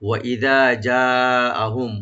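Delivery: slow, loud, drawn-out chant; slow Quran recitation. Shown as a demonstration. Tajweed teacher reciting the verse slowly for students. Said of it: "ওয়া open রাখবেন".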